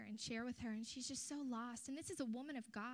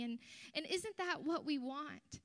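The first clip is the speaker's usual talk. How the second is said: ordinary talk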